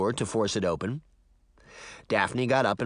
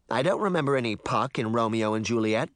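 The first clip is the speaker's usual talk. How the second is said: ordinary delivery, nothing marked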